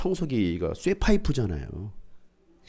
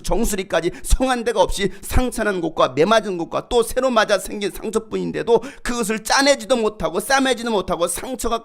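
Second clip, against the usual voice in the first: preaching God's rebuke; 9 semitones higher, 6 dB louder than usual. Acted. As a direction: thunder it from the pulpit. Declaims God's lament. (높고 힘찬 꾸짖는 설교조)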